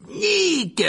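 very high, loud hail; boisterous shouted greeting; roaring. A narrator's reading. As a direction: bellow the name in a high voice. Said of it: "rugit-il".

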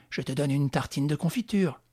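ordinary delivery, nothing marked

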